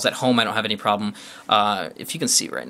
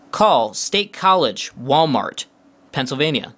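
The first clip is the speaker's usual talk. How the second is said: slow, higher, loudly projected command; 3 semitones higher, 4 dB louder, slower than usual. Shown as a demonstration. Reviewer gives the phone's search a call command, slow and deliberate. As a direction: spell it out for the phone, word by word.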